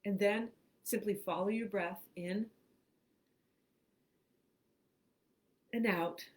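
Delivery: unhurried phrases with long pauses; guided meditation. Acted. Host reads a relaxation script to online listeners, guided meditation. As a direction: guide them through breathing, leaving room after each phrase.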